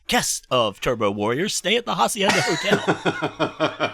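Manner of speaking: slow mock commercial voiceover; ad announcer voice. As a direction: sell the hotel like an old radio ad. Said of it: "this voice going".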